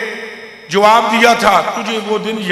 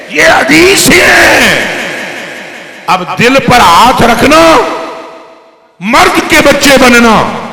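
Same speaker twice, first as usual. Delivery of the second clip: loud, slow, emphatic sermon delivery; full-force preaching; 5 dB louder, slower than usual. Acted. Preacher challenges listeners to accept praise of Siddiq, full-force preaching. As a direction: drive each phrase home at full volume.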